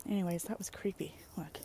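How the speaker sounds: hushed creepy whisper; whispered aside. Real, not acted. Vlogger hushing over something creepy appearing, whispered. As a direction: lean in and whisper it.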